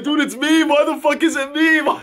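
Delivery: high-pitched pretend panic; playful, squealed mock meltdown; mock freak-out. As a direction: act out freaking out, hammed up. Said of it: "I wanted to just freak out, be like".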